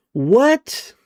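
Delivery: sudden high what; surprised. Real, not acted. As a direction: blurt it out, shocked. Reacting to a chat story.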